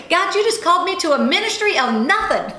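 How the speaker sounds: high, loud mock-indignant complaint; retold outburst, half laughing; mad at God. Speaker retelling her treadmill rant to God, comically mad. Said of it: "I'm mad at God".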